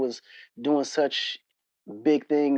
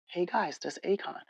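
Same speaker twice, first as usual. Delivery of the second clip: lightly imitated hushed, higher voice; soft-spoken tip-off; 4 semitones higher, 10 dB quieter than usual. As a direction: quietly point out a celebrity, as in retelling. Voicing Mike tipping off kids.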